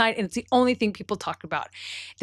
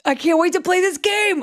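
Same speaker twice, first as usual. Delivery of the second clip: high, loud and eager; can't wait, bursting with glee; excited; 11 semitones higher, 6 dB louder than usual. Real, not acted. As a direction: squeal with delight at the game. Eager response to game.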